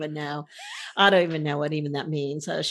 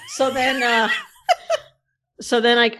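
louder and higher, breaking into laughter; laughing; 6 semitones higher, 7 dB louder than usual. Real, not acted. Picking the story back up while laughing at herself. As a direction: laugh through the restart.

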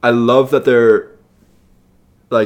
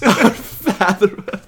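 laughing echo; 9 semitones higher, much slower than usual. Real, not acted. Echoes quote while laughing.

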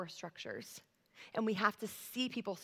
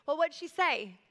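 raised, higher voice of a mom calling over; mom calling in background; 6 semitones higher, 6 dB louder than usual. Retold or imitated. Friend's mom asking about the child's phone call, calling out. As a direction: call it out as another parent in the background. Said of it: "friend's mom in the background going".

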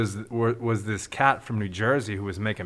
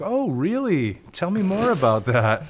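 higher-pitched, exaggerated sarcastic interest; mock sarcasm; 7 semitones higher, 4 dB louder than usual. Son to dad about his old school, mock sarcastic. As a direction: imitate a sarcastic kid feigning fascination. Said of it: "developed sarcasm".

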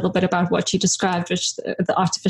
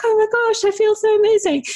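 high, delighted gasp of relief; excited; 14 semitones higher, faster than usual. Retold or imitated. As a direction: sound thrilled and refreshed. Retold feeling after cold water.

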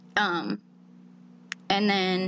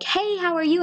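friendly greeting; 11 semitones higher than usual. Retold or imitated. Voicing acquaintance greeting her.

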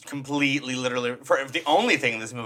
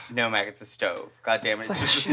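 low, quiet fed-up correction; mock exasperation; 6 semitones lower, 4 dB quieter than usual. Acting out Gorgeous setting Mac straight, wearily exasperated. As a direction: sigh it out low, out of patience. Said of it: "Gorgeous is not even having either".

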